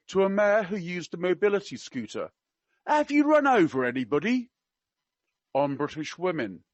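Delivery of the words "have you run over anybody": high, loud mock-Cockney question; attempted Cockney. Quoting the prince to a mayor, in attempted Cockney. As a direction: pitch it up in a try at Cockney. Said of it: "I can't do Cockney. I will try my best".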